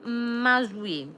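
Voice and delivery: put-on playful, lightly done French accent; hammed-up French reply; mock French accent. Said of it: "Is that a Charleston French accent?".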